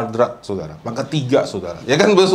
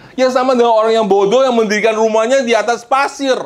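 high, ringing pulpit voice; raised, emphatic preaching; forceful scripture declamation; 10 semitones higher, 5 dB louder than usual. Real, not acted. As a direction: proclaim the verse as a warning.

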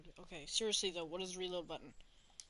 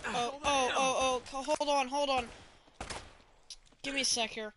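loud, high-pitched flustered stalling; urgent alarm; 5 semitones higher, 9 dB louder than usual. Real, not acted. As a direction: blurt it out loudly, caught under fire. Caught under fire in game.

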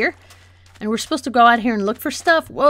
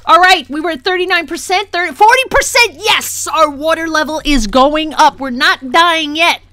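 raised, high elated running commentary; excited triumph; 4 semitones higher, 6 dB louder than usual. Real, not acted. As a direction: cheer on the climb, loud and relieved. Water level finally rising.

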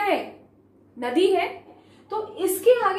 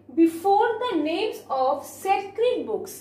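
slow dictation; much slower than usual. Shown as a demonstration. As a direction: read the rule word by word. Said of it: "slow dictated rule".

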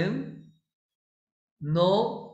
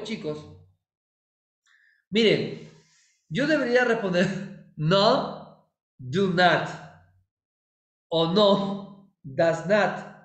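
normal talking voice

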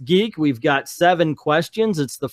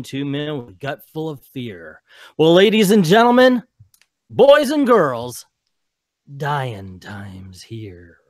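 Thunderdome announcer; 4 semitones lower than usual. Acted. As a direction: work the crowd like a showman at the cage. Arena announcer movie speech.

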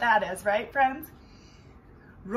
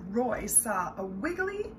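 plain reading voice

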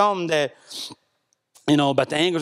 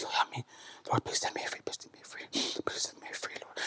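breathy whispered plea to the Lord; whispered prayer; 4 semitones lower, 15 dB quieter than usual. Retold or imitated. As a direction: whisper it, begging to be set free.

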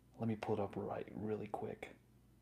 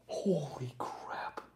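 slow, breathy amazement; stunned disbelief; 3 semitones higher, much slower than usual. Real, not acted. Streamer absorbs the card's value, slow breathy disbelief. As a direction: draw it out, winded with shock.

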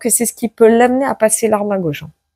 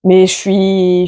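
ordinary talk

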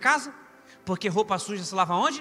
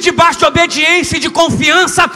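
full-force preaching; 7 semitones higher, 15 dB louder than usual. Real, not acted. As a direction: shout the promise out to the congregation. (pregação pentecostal a plenos pulmões)

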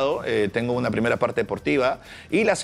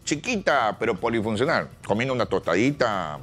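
Vizcarra impression; 6 semitones lower than usual. Impressionist voicing Vizcarra at home, low cosy politician voice. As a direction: coo the diminutives, pitched low. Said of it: "yo le imito a Vizcarra".

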